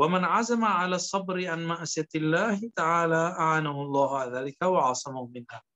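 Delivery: ordinary talk, nothing marked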